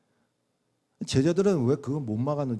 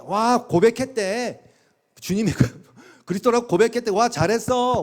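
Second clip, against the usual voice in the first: naive amazement; 6 semitones higher than usual. Retold or imitated. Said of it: "그렇게 읽으시면 안 돼요".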